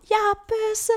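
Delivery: high, excited mock yell of coming out; retold shout. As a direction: yell it into the night like a first confession. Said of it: "Og så råber han bare".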